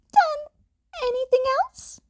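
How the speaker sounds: high, chirpy small-animal voice; a bright little principal; Nezu's voice. A narrator's reading. Nezu voiced.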